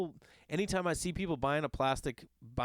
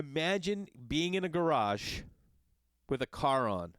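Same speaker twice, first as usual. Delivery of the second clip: usual speaking voice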